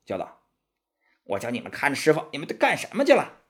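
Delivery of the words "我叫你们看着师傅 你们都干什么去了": stamp and tear into them; furious scolding. Acted. Monkey King berating his brothers for losing the master, fast furious scolding. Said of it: "孙悟空气得直跺脚，叫道".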